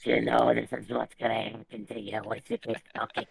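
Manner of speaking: rough gravel-throated warning; gravelly voice. Retold or imitated. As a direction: grind the words out like gravel. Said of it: "he talks to you in a gravelly voice".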